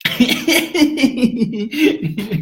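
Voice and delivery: high laugh. Real, not acted. Laughs after clearing throat.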